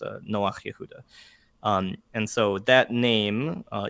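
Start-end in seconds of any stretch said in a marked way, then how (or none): 0.3-1.0 "Noach Yehuda" in Hebrew, guttural chet ending "Noach"; Hebrew pronunciation. Shown as a demonstration. Giving his full Hebrew name, "Noach Yehuda" with guttural chet. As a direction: say "Noach Yehuda" with a throaty friction at the end of "Noach". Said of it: "My full Hebrew name is".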